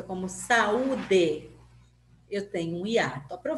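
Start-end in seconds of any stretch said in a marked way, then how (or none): 0.3-1.5 "saúde" drawn out slowly, A and U given separate weight; equal-force vowels. Shown as a demonstration. Teacher showing pupils a hiatus, slow equal stress in "saúde". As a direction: pull "saúde" apart as sa-ú-de. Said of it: "as duas com a mesma força".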